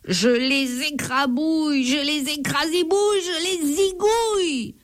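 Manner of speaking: high, slowing, gloating giant; chasing giant threat. A narrator's reading. The giant Colosse gloats as he chases the thieves. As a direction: gloat like an ogre on the hunt.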